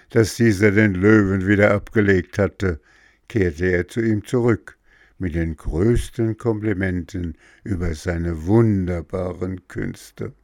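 ordinary delivery

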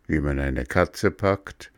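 usual speaking voice

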